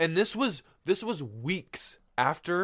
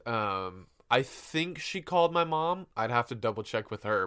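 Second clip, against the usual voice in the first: ordinary talk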